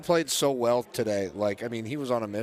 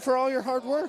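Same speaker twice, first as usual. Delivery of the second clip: high voice quoting a friend; a light, high put-on voice; 10 semitones higher, 4 dB louder than usual. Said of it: "He said".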